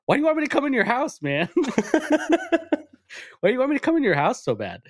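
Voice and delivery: imitating a high-pitched, rushed outburst; mock exasperated outburst, pitch shooting up fast; losing it. Friend snapping at the car seller, acted-out exasperation. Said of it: "My friend instantly loses".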